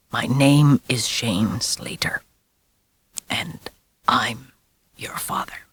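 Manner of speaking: whisper. A narrator's reading. Werewolf father whispering the boy's fate into his ear. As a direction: whisper it like a curse.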